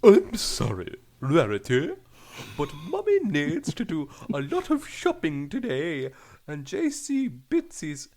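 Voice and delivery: a hint of a posh British mum; lightly voiced British mother. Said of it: "sounds a lot like the".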